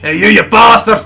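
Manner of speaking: high, loud Scottish heckle; full-throated demo of Scottish swearing; Scottish insult, shouted. Shown as a demonstration. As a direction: bellow abuse like an angry Scot. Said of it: "I've got a better one".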